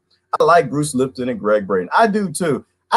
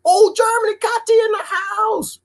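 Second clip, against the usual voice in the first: excited greeting. Real, not acted. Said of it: "greeting a viewer".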